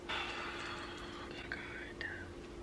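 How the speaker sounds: words barely breathed through tears; tearful whisper. Real, not acted. Vlogger whispering to viewers while tearing up. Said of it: "I'm literally tearing up".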